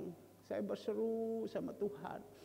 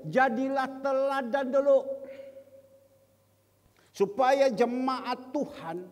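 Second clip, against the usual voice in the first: loud, raised pulpit exhortation; full-force preaching; 6 semitones higher, 9 dB louder than usual. Acted. Preacher urging leaders to be examples first, full-force preaching. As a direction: press the charge on them loudly and firmly.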